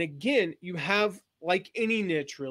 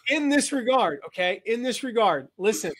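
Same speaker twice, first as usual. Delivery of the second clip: mock serious; 5 semitones higher, 5 dB louder than usual. Mock-grave emphasis, audience laughing.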